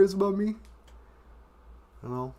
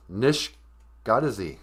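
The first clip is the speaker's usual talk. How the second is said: ordinary talk, nothing marked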